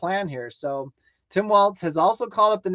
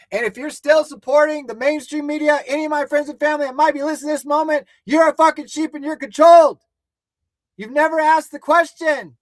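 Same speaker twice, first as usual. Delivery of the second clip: higher, louder furious tirade; real outrage, raised pitch and volume; angry rant; 6 semitones higher, 5 dB louder than usual. Real, not acted. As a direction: lash out at friends and family for real. Berating supporters of mainstream media.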